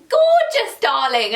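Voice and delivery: hammed-up gush, high and loud; family repeating the compliment, mock gushing high and loud. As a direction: overdo the praise like a doting relative. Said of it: "they'd be like".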